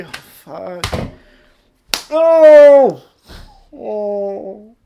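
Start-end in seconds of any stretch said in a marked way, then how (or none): 2.1-3.0 high, loud drawn-out oh; loud exclamation. Pretend. Loud high oh as he bursts in on his cohost. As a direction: shout a big theatrical oh.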